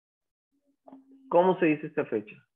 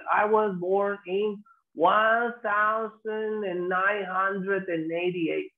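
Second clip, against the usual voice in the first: very slow, drawn-out, higher "one thousand and eighty-eight"; odd long-winded year; 4 semitones higher, much slower than usual. Shown as a demonstration. Teacher mocks what people never say, laboriously drawn-out reading. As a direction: drag out every word to show how odd it sounds. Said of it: "No, se escucha hasta raro".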